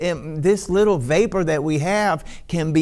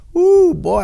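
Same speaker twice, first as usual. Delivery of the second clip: ecstatic cry; 10 semitones higher, 7 dB louder than usual. Real, not acted. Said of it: "My goodness".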